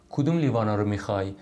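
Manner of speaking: usual speaking voice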